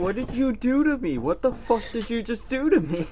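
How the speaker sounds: high outraged yell. Real, not acted. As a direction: yell it at a friend. Accusing after being killed.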